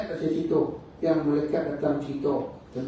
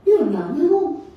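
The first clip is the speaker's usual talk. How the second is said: urgent questioning; 8 semitones higher, 7 dB louder than usual. Acted. Prophet pressing the silent angel, anxious rising questions. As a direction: pitch climbing much higher and louder to an anxious cry.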